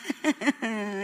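soft, low forced chuckle; humorless laugh. Retold or imitated. Woman re-enacting laughing on God's orders, humorless laugh. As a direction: imitate a weak laugh with no fun in it. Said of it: "comecei aquela risada sem graça".

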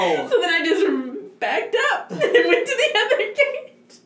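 high voice breaking into laughter; real laughing confession. Real, not acted. Telling friends about fleeing the broken gate, high and laughing. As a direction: push the story out while cracking up.

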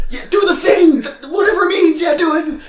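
very high, loud excited yelling; excited shouting. Retold or imitated. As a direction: yell like a hyped fan. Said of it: "lose my mind".

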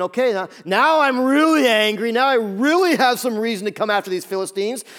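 angry Samson. Retold or imitated. Samson furious at the Philistines, loud hammed-up anger. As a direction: build into a mock rage. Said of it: "And so he's thinking".

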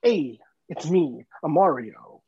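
lightly voiced, slow, low-pitched impression; a hint of a movie actor as a game hero; Steve Buscemi. Actor cast as Mario introduces himself, slow light impression. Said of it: "get Steve Buscemi in there".

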